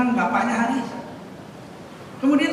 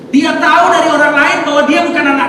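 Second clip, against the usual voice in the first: fast, high, loud preaching; loud exhortation; 7 semitones higher, 6 dB louder than usual. Real, not acted. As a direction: warn the congregation at full force. Preacher exhorting congregation loudly. (khotbah keras dan berapi-api)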